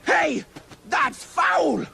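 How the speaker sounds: high, punchy cry of protest; outraged exclamation; indignant shout. Acted. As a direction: call out the foul.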